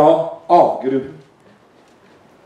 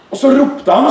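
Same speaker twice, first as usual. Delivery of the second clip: usual speaking voice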